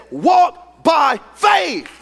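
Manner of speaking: high, loud, drawn-out cry; forceful preached command. Retold or imitated. Preacher quoting the Spirit's command to her, forceful. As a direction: stretch each word out at full force.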